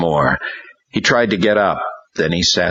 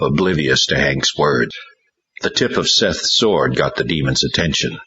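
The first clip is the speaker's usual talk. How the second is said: plain reading voice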